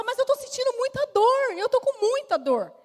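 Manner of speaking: high, loud pleading; pained plea. Retold or imitated. Patient pleads with the doctor in pain, high and loud. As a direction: beg through the pain.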